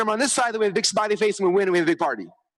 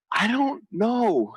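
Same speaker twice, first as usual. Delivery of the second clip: very slow, drawn-out I don't know; helpless shrug; 2 semitones higher, much slower than usual. Retold or imitated. Stretch it out like you're stumped. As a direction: coach admitting to a client he is lost, slow helpless shrug. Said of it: "I'm like".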